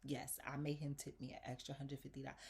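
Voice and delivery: ordinary delivery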